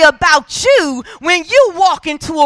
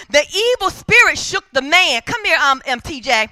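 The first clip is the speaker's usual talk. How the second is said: usual speaking voice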